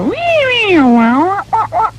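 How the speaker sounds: high sung wee wah wah; echo guitar noises. Shown as a demonstration. Musician showing the band's guitar sound, mock wailing. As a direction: imitate a guitar drenched in echo. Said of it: "stick loads of echo and things on and just go".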